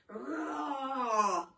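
loud playful roar; lion roar. Shown as a demonstration. Teacher demonstrating a lion roar to children, loud. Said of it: "practice your big lion roar for me".

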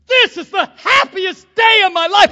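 high, loud cry of joy through tears; sobbing, shouted joy; tearful joyful shout. Retold or imitated. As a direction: cry it out with overwhelming happiness. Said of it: "He goes".

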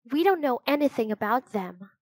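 usual speaking voice

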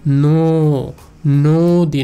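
loud, drawn-out "nooo", then নদী as "nooo-dii"; stretched o-sound. Shown as a demonstration. Teacher shows the changed vowel, loud stretched o-sound. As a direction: hold the rounded o long in নদী. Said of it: "ও হয়ে যাবে".

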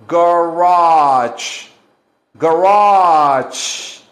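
Garage with a ch sound at the end, garach; Garage as garach, loud teacher model; pronunciation demo. Shown as a demonstration. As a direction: say Garage ending in a hard ch, loud and slow. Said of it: "Hay que pronunciarlo así".

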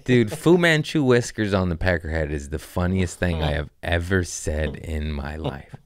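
ordinary talk, nothing marked

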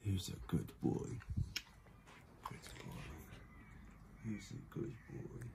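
whispered praise. Real, not acted. Praising the dog beside the sleeping baby, whispered.

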